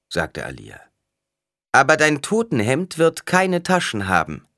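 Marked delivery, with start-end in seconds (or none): 1.7-4.5 loud, raised warning; stern proclamation. A narrator's reading. Alia warns the greedy man, proclaiming sternly. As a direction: deliver it as a public verdict. Oracle rebukes a petitioner.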